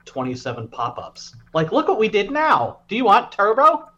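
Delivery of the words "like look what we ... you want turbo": high, loud cheery sales pitch; pushy pop-up ad. Retold or imitated. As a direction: hype it like an app ad. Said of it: "it has, like".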